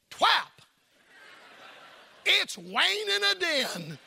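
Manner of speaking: put-on high, slow toddler lisp on Twap; a two-year-old parroting her father, slowly; toddler copying dad. Two-year-old repeating dad's words in toddler voice. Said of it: "and she said".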